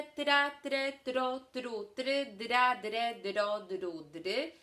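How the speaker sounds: tra, tre, tro with a crisp rolled R; closing practice drill; syllable drill. Shown as a demonstration. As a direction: rattle through the syllables for practice. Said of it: "zachęcam Cię do ćwiczenia tych sylab".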